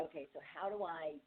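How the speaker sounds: soft, half-murmured inner question on how to handle it; unsure self-questioning. Retold or imitated. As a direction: quietly puzzle it out to yourself. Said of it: "to say".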